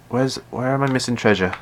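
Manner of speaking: ordinary talk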